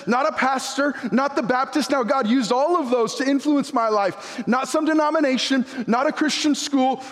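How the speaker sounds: high, driving preacher voice; preaching at full force. Acted. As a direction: preach hard to the congregation.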